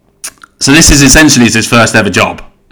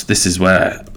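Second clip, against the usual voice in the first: usual speaking voice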